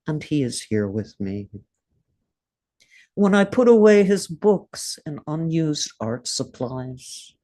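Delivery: measured, grieving poetry reading with long pauses; tender recitation of a poem for a late husband. Acted. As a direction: read it like an elegy, letting each line land. Reads her poem aloud.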